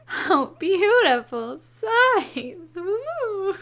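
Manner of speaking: slow, loud, high teasing swoon; mock swooning. Real, not acted. Player teasing Alonzo's flirting, loud mock swoon. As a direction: ham up a cheeky whoop.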